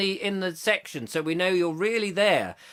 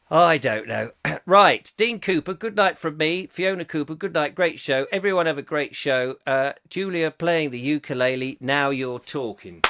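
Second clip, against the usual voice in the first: normal talking voice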